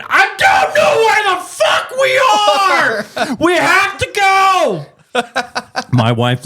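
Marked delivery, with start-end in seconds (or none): 0.0-4.9 high, slow, half-crying shout; desperate yell. Retold or imitated. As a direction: yell it like you're lost and at your limit. Him yelling at stuck wife.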